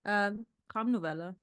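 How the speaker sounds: words said in a German accent. Shown as a demonstration. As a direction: say it the German way, on request. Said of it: "can you do a nice German accent for us".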